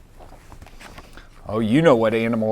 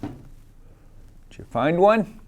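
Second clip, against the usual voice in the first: gentle question; 6 semitones higher, much slower than usual. Real, not acted. Asking toddler, drawn out.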